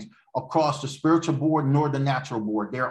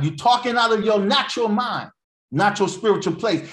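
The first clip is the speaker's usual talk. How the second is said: high, louder preacher's scolding; scolding rebuke; 8 semitones higher, 5 dB louder than usual. Acted. Preacher rebuking the fleshly mind, scolding. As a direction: call out the offender, pitched up.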